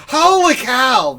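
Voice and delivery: loud, high, slow announcer whoop on Holy cow; Harry Carey. Retold or imitated. Host mocking chat's guess, booming baseball-announcer imitation. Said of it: "Harry Carey is not doing".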